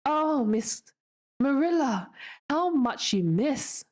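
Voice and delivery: high, drawn-out exclamation; amazed pity. A narrator's reading. Anne lamenting how much Marilla misses, amazed pity. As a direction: marvel slowly, pitched well up, lamenting.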